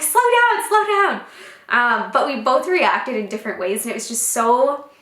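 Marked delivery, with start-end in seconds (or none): 0.0-1.2 high, louder mock panic; urgent plea. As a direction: rein in an overeager partner, high-pitched. Retold plea to hurried husband.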